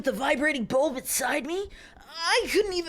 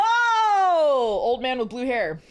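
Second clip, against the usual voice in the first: slow mock announcement; 6 dB louder, much slower than usual. Real, not acted.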